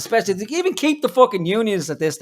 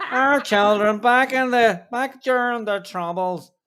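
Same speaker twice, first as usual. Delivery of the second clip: slow, higher mock Northern Irish accent; 6 semitones higher than usual. Parodying the politician on screen in a mock Northern Irish accent. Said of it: "your northern accent is getting better".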